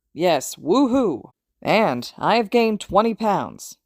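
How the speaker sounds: slow, drawn-out hero voice; a hint of a proud hero voice; slow proud cheer. A narrator's reading. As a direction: savour each word like a victory. All Might echoing cheer.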